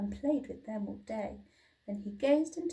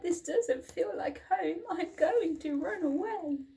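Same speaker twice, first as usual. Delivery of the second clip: much higher, plaintive cry; wailing little dinosaur; 7 semitones higher than usual. A narrator's reading. Little dinosaur crying out when left behind. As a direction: wail it like an abandoned hatchling. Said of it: "he cried".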